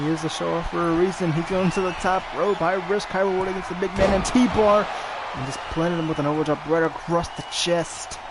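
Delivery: fast, rising, shouted play-by-play; wrestling commentator. Acted. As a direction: build the hype as he climbs. Live play-by-play of a spot.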